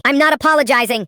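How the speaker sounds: high, loud defiant scream. A narrator's reading. Sister screaming refusal at grandpa. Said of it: "she screamed".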